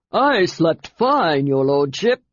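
a hint of a blustering shout; said loudly. A narrator's reading. Toad to the Emperor, a slow, mildly loud reply. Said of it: "said Toad loudly".

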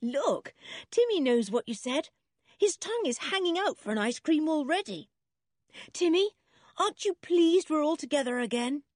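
put-on high, bright-eyed excited child; happy, high-pitched excitement; delighted chatter. A narrator's reading. George delighted, talks to her dog.

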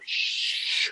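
long voiceless shush; drawn-out "shh" to silence someone; hushing shh. Retold or imitated. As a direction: shush a friend who says too much. Retold hushing of friend's compliment.

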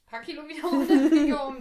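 laughing. Real, not acted. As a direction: laugh through the end. Laughs mid-sentence.

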